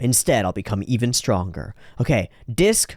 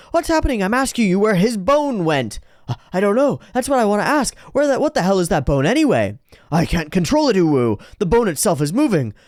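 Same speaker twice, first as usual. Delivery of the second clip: frantic high voice; 10 semitones higher than usual. Acted. Frantic argument over vanished bone.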